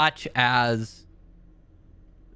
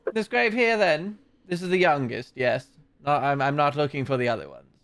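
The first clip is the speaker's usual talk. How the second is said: abbot voice; 2 semitones higher, faster than usual. Acted. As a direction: ask it lightly, a pitched-up priest checking the grave. Abbot questions Dimitri.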